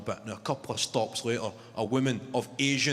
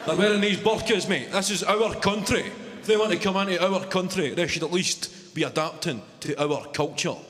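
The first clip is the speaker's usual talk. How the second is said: mock bigot rant; 5 semitones higher than usual. Imitating the bigot on the train ranting about foreigners, pitched up.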